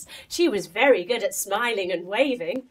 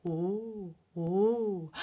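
wobbly gasp; 4 semitones lower, 7 dB quieter than usual. A narrator's reading. Wobbling on tightrope.

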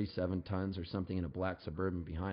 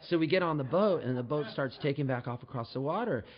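ordinary delivery

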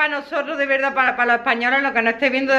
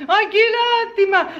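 high pitying sigh; 8 semitones higher than usual. Real, not acted. Pitying the winners again.

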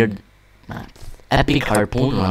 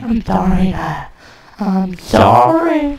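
high, whiny little son voice; child apologising to Dad; 8 semitones higher than usual. Acted. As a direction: apologise in a small high voice, drawing out the last sorry.

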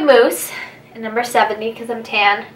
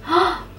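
gasp; 8 semitones higher, 4 dB quieter than usual. Real, not acted. Forgot makeup wipes.

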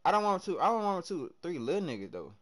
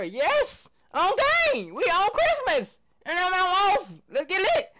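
high, loud hype about playing on Christmas; hyped shout; 12 semitones higher, 5 dB louder than usual. Real, not acted. Streamer hyping up chat about Christmas games, loud excited shout. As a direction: shout it out, pumped for the holiday games.

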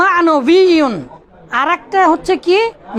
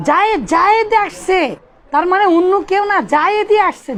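higher, Zayed Zayed hammered; emphatic; 5 semitones higher than usual. Shown as a demonstration. Teacher stressing Zayed to show emphasis to students. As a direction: stress জায়েদই, no one else came. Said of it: "জোর দিচ্ছেন না বাবা?".

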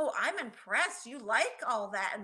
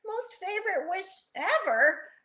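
high, reluctant storybook voice; mildly worried little character giving in; doubtful; 9 semitones higher than usual. A narrator's reading. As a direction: agree with misgivings, pitched way up. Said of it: "Rocky said doubtfully".

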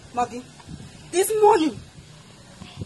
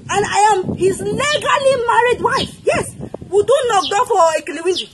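tearful shout; 3 semitones higher than usual. Acted. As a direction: wail it out, half crying. Wife confronting her husband's girlfriend.

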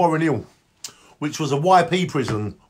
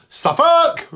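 shouting Suffolk; 10 semitones higher than usual. Retold or imitated. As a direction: yell it down the prison queue. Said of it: "I used to say".

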